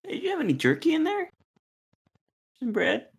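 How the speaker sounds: high, fast begging voice; high pleading character voice. Acted. Starving characters beg for food.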